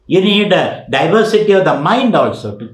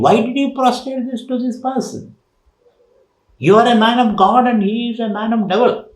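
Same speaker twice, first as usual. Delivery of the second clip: indignant disciples; 8 semitones higher than usual. Retold or imitated. Disciples question their master.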